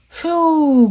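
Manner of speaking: high, drawn-out storybook phew; relieved phew. Acted. Grandma Shark has fixed Baby Shark, relieved phew. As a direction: let the relief out in one falling sound. The fix worked.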